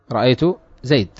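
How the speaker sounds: زيد ending on a bare d; pause by deletion. Shown as a demonstration. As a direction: separate and stop on زيد without a vowel. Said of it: "فكيف سيقولون".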